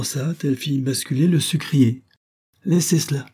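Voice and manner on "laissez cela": higher, curt impatient order; irritated first command. A narrator's reading. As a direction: brush the maid off nervously. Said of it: "fit monsieur Duranton impatienté, nerveux".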